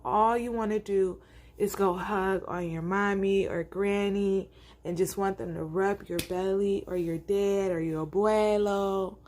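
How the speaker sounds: plain reading voice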